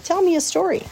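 high, pleading little-pig voice; pig's plea again. A narrator's reading. Wilbur repeats his plea.

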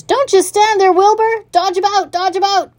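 high, loud, urgent commands; goose crying orders. A narrator's reading. Goose taking command of the runaway pig, high urgent cries. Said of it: "cried the goose".

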